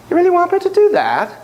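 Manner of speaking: high-pitched, hammed-up Irish accent; ridiculous Irish brogue. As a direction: do a silly Irish spirit voice, rising at the end. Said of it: "sorry for the ridiculous accent".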